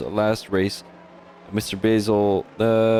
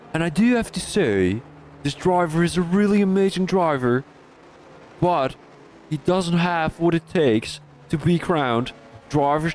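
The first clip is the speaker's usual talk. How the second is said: higher-pitched British race commentator; British accent, pitched up a little; attempted British accent; 4 semitones higher than usual. Shown as a demonstration. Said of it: "It was really a British accent".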